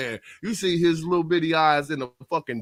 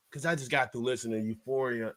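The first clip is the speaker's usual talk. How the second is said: ordinary talk, nothing marked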